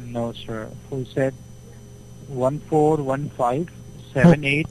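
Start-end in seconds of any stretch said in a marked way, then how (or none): none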